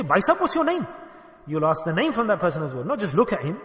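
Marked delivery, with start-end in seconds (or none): 0.0-0.9 acted-out happy, high-pitched eager question; overjoyed. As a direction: ask louder and much higher, as if delighted. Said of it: "three hundred sixty degrees in happiness".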